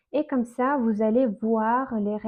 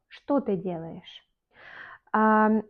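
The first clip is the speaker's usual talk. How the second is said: usual speaking voice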